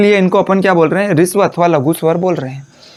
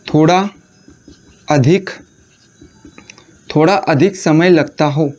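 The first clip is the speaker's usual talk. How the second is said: dictation; 3 semitones lower than usual. Acted. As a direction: read it out so students can write. Dictating a definition for notes. (रुक-रुक कर notes लिखवाना)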